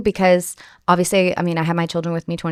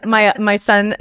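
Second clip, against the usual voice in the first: ordinary talk, nothing marked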